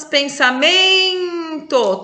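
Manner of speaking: slow, drawn-out demo of a word; slow stretched reading. Shown as a demonstration. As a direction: hold each syllable to show the count. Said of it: "porque são sílabas átonas".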